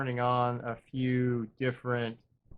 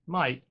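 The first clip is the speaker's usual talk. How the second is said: "might" lifted higher and leaned on; stress on might; 4 semitones higher than usual. Shown as a demonstration. Player hedging about escaping the death spiral, stressing "might". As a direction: punch the word "might" with a hopeful lift. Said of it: "big emphasis on the word might".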